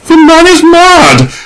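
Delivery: very high, slow, loud tragic cry; tragic exclamation. A narrator's reading. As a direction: wail it like a tragedy queen. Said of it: "exclaimed Adela tragically".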